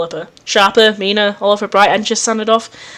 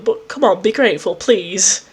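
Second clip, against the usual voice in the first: ordinary delivery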